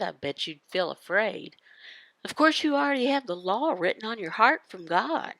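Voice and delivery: ordinary delivery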